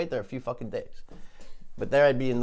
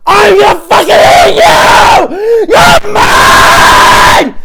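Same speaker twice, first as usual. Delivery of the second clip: very loud, much higher, slow roar; furious shout; 22 dB louder, much slower than usual. Acted. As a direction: scream it in his face. Hunter screaming at turkey.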